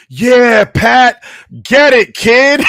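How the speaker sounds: high, drawn-out heckler shout; drunkard's scream. Retold or imitated. Drunkard heckling a golfer at the tee, screamed out. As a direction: yell it out like a drunk fan. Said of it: "some drunkard screams out".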